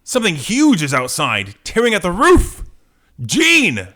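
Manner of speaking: loud, high cry of alarm; alarmed hero. Acted. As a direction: shout a warning, rising to a yell. Scott reacts to attack.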